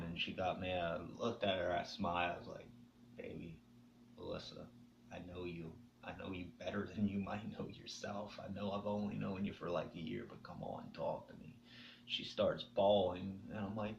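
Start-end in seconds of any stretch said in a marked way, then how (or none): 3.2-11.6 a soft retold plea to open up; tender coaxing. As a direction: gently coax her to talk. Retold coaxing words to girlfriend.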